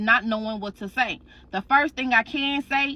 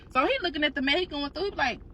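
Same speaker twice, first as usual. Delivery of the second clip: usual speaking voice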